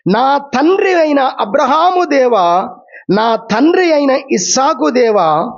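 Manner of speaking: slow, drawn-out calling on God; slow solemn prayer voice; slow prayer reading. Acted. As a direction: read the patriarch's plea slowly. Reading Jacob's prayer aloud.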